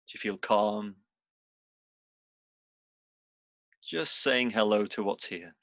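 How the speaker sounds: unhurried meditation guide, long pauses between phrases; guided meditation. Real, not acted. Teacher guiding seated students into body and breath, guided meditation. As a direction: lead them gently through it, leaving space after each instruction.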